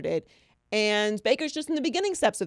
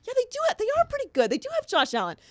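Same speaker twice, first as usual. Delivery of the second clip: much higher, mock-persuaded voice; mock convinced thinking; much faster than usual. Host voicing your infiltrated brain, high mock-convinced. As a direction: squeak it out like someone talked into believing. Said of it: "then you start thinking".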